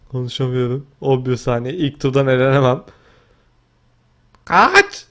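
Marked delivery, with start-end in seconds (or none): 4.5-5.1 much higher, loud scream to run; panicked yell. Real, not acted. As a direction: shriek it in panic. Fleeing in game.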